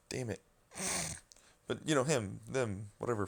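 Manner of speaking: ordinary talk, nothing marked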